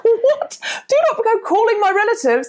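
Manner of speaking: high shouted command; furious order. Retold or imitated. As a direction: forbid it in outraged panic.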